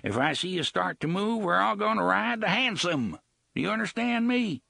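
pirate shouting terms to the gunslinger. Acted. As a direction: call it across the bridge. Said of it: "Gasher raised his voice again".

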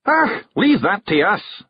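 a pixie chief's Scottish holler; Scottish shout. A narrator's reading. Rob Anybody takes over the tea, Scottish shout. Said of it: "shouted Rob Anybody".

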